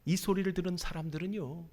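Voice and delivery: usual speaking voice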